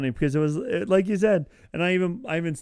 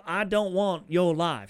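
slow, higher-pitched Southern drawl; Southern accent; 5 semitones higher, slower than usual. Retold or imitated. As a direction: imitate a drawn-out Southern accent. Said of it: "like, that Southern accent".

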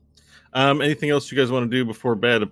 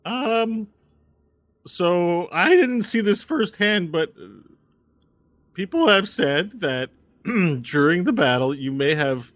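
high, halting voice, slow in places; hesitant Walnut; 9 semitones higher, slower than usual. Acted. Telling the sleeper about a conjured moon, high and hesitant. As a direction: break awkward news haltingly.

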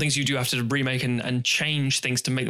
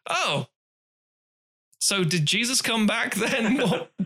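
higher, mock-incredulous questioning; baffled exasperation; 6 semitones higher than usual. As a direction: rise higher as each confused question piles up. Acting out baffled reaction to ending.

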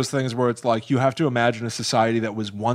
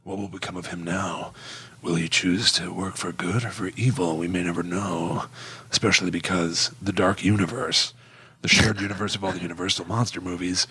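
movie narrator voice; 7 semitones lower than usual. Retold or imitated. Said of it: "And they're like".

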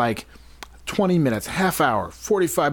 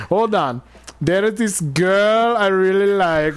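put-on higher, hammy African accent; African security guard voice; 7 semitones higher than usual. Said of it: "I'm good with my African security guard voice".

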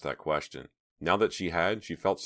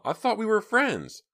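high, louder wounded plea; high-pitched reproach to a friend; hurt outburst; 5 dB louder than usual. A narrator's reading. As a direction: blurt out the hurt. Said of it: "Myra blurted out".

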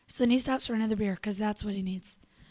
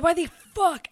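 angry outburst; 7 semitones higher, 6 dB louder than usual. Retold or imitated. Retelling her outburst.

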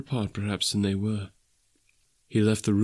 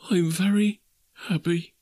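higher, earnest declaration; moved, happy father; 9 semitones higher than usual. Acted. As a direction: say it with feeling in a raised pitch. Bob repeats, overcome with feeling.

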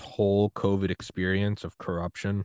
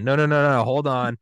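louder, higher, rapid-fire interruption; urgent objection; 6 semitones higher, 6 dB louder than usual. Acted. Someone in a future pandemic stopping others, urgent objection. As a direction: cut in to halt everyone.

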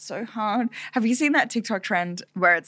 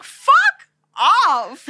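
high, loud burst of swearing; mock exclamation; 10 semitones higher, 8 dB louder than usual. Retelling ski lift argument, loud mock swear. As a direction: blurt it out, hammed up. Retold outburst at mum.

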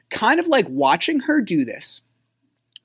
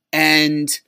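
ordinary talk, nothing marked

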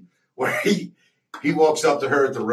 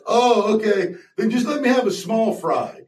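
higher customer voice; 9 semitones higher than usual. Retold or imitated. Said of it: "the other guy goes".

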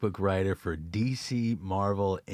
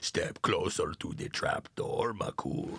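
low, slow whispery villain voice; villainous character; 6 semitones lower than usual. Acted. Opens with a villain-like bit.

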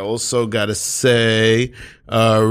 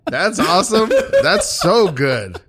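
high, laughing delight; surprised; much slower than usual. Real, not acted. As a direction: burst out in happy surprise. Delighted reaction to joke.

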